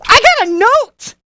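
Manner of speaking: high, giddy exclamation; excited squeal. Real, not acted. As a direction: burst out with delighted disbelief. Thrilled, repeats the discovery.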